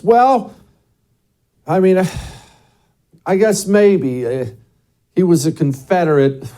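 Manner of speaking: high-pitched, hammed-up reluctant caving; mock someone hedging, then pleading as they give ground; waffling pushover. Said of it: "would you say this?".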